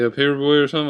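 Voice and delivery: ordinary talk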